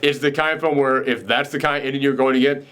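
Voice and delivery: ordinary talk, nothing marked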